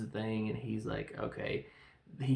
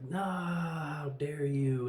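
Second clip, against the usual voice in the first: villain outrage; 3 semitones higher, 4 dB louder, much slower than usual. Retold or imitated. Villain reacts to sacrifice.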